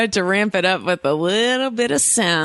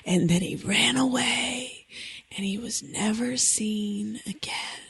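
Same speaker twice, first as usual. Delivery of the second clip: slow, much softer ghost story whisper; campfire whisper; 2 semitones higher, 9 dB quieter than usual. Pretend. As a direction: hush it out like a flashlight-under-the-chin tale. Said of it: "Are you holding a flashlight under your chin?".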